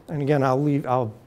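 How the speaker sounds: usual speaking voice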